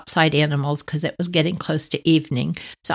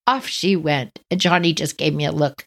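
ordinary talk

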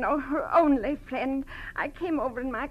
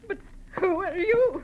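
crying question, raised; tearful; 7 semitones higher than usual. Acted. Tearful friend asking the detective who he is. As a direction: ask through tears.

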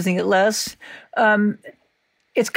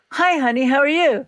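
higher-pitched, cheerful phone hello; good-mood greeting; 6 semitones higher, faster than usual. Retold or imitated. Imitating mother answering the phone in a good mood, cheerful. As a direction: imitate a mother happy to hear from you. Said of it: "If she was in a good mood".

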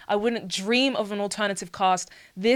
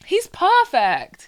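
gushing praise; 10 semitones higher, 6 dB louder, much slower than usual. Real, not acted. Gushing over a casting pick.

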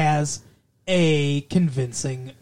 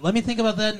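quick line delivery; 5 semitones higher, much faster than usual. Retold or imitated. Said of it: "that's how she said it".

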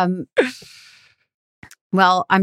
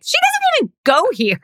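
much higher put-on voice for the snide line; mock his deadpan jab, pitched way up; a higher, more put-on take of the same quote; imitating Damon. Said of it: "And Damon is like".

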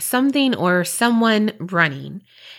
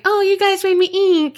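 high-pitched cartoon character quote; playful, much higher movie-line voice; Finding Nemo line; 11 semitones higher than usual. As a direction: squeal it like a little cartoon sea creature. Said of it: "the line you always say from Finding Nemo".

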